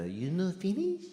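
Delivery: put-on waitress asking in a Japanese accent. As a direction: mimic the waitress's Japanese accent, slow and soft. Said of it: "I have to do the accent".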